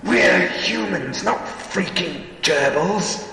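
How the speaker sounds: high, loud, outraged yell; furious yell. A narrator's reading. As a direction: yell it in fury. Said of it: "I yelled".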